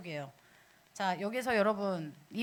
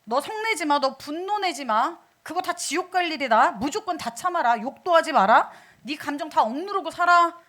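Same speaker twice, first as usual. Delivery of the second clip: loud, raised-pitch barrage of commands; mock stern Jesus; 4 semitones higher, 8 dB louder than usual. Preacher parodying Jesus forbidding anger to believers, mock stern commands. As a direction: hammer out each prohibition like a strict taskmaster. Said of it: "그러면 예수님이".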